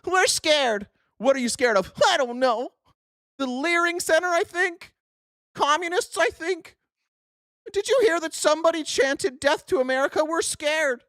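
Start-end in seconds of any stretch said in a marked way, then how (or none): 0.1-0.9 higher, whiny-scared imitation; mock scared chatter. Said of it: "exactly what you losers sound like in the chat". 2.1-11.0 high, clueless frightened imitation; mock scared chatter. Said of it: "exactly what you losers sound like in the chat".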